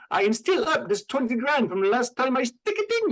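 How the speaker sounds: high, hammed-up Russian accent; over-the-top Russian accent, imitating Malkovich. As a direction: play a cartoony Russian gangster accent. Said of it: "his Russian accent".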